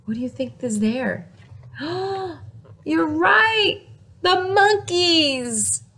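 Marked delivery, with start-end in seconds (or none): 1.6-5.8 excited gasp. Acted. Excited reveal to children.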